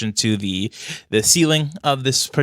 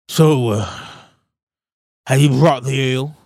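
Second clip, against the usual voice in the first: louder, quicker royal demand; impatient dwarf king; 4 semitones higher, 5 dB louder than usual. Acted. King demanding the ale from the party, louder and quicker. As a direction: press them for the ale.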